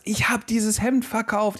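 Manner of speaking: high-pitched, drawn-out proud boast; proud announcement. Retold or imitated. Husband mimicking wife after her shirt sale, full of pride. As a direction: imitate a triumphant seller, slow and high. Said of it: "sagt sie voller Stolz".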